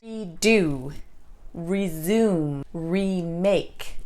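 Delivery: REdo, REsume, REmake, full "ree"; stress on re. Shown as a demonstration. Teacher showing re meaning again in Redo, resume, remake, stressed re. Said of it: "the re is always stressed".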